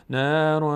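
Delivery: نارا with the ن hidden through the nose; nasal ikhfa. Shown as a demonstration. Teacher shows nasal ikhfa on نارا, held.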